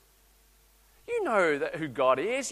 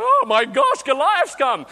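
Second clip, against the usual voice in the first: higher, louder playful alarm; mock fright; 7 semitones higher, 10 dB louder, slower than usual. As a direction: gasp in pretend terror at a giant. Scared child sees big man.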